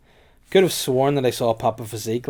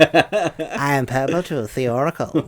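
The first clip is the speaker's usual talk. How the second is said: slow, higher oracle voice; Purple Tooth voice; 3 semitones higher, 5 dB louder, slower than usual. Pretend. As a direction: intone like a mystic oracle. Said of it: "Purple Tooth has a voice like that".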